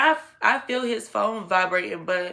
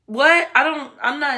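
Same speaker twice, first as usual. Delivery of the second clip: high, loud incredulous what; disbelief; 7 semitones higher, 5 dB louder than usual. Real, not acted. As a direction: shoot it up in disbelief. Disbelief aside to listeners.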